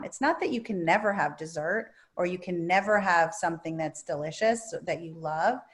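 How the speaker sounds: normal talking voice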